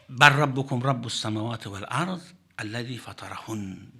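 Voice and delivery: fast, fluent Quranic recitation. Acted. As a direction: chant the verse briskly, reverently.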